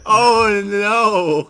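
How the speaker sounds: high squealing laugh. Real, not acted. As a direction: burst out laughing. Laughing fit at the joke.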